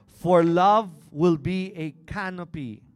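loud, driving sermon delivery; preaching at full force. Acted. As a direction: preach it out to the congregation.

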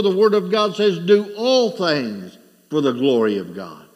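loud, rising preacher's cry; preaching at full force. Acted. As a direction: drive the point home to the congregation.

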